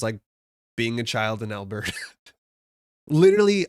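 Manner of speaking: usual speaking voice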